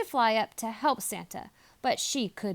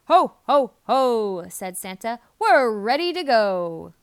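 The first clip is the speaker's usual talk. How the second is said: big, louder jolly ho ho ho; jolly Santa; 8 dB louder than usual. A narrator's reading. Santa cheers his team that they're ready. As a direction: boom it out like Santa.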